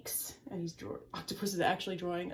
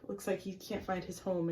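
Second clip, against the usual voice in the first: ordinary delivery, nothing marked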